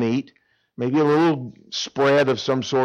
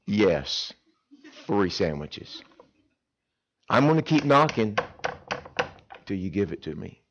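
soft stubborn insistence; 5 semitones lower, 5 dB quieter than usual. Acted. Persistent asker soft.

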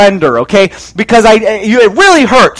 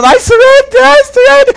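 much higher, strained falsetto pleading; squeaky surrender. Retold or imitated. Narrator begging the shooter to stop, high squeaky pleas. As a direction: squeal it out, nearly in tears. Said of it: "he's almost in tears at this point".

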